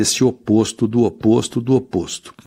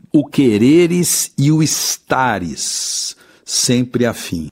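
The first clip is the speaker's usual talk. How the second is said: slow poem recitation; 4 semitones higher, much slower than usual. Shown as a demonstration. Teacher recites verse slowly.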